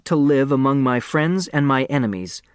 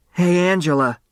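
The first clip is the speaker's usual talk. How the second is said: raised voice across a table, higher; calling across a table; 6 semitones higher than usual. A narrator's reading. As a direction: call out down the long table. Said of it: "called the length of the table".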